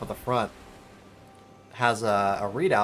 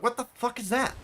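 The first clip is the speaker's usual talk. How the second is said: jolted awake, much higher; startled; 12 semitones higher than usual. Retold or imitated. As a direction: snap awake confused. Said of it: "I'm like".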